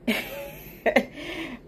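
a soft unvoiced chuckle; breathy laugh. Real, not acted. Vlogger chuckling while choosing the picture, soft breathy laugh.

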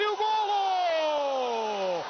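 very long held golo; goal call. Real, not acted. Goal call in futsal commentary.

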